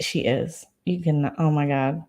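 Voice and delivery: ordinary talk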